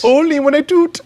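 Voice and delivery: high, dainty fey voice; foppish, fey character. Pretend. Fey creature answering about making rainbows, in a high foppish voice. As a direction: confide it like a precious fop. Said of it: "Very foppish".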